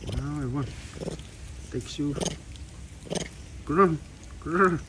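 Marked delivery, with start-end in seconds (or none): 3.6-4.8 high growly monkey call; playful monkey grr; monkey growl. Retold or imitated.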